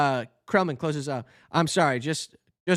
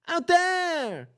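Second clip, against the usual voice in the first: much higher, ringing exclamation; excited declaration; 11 semitones higher than usual. Acted. Barry tells Adam he's going outside, thrilled and high. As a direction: fling it out with wide-eyed thrill.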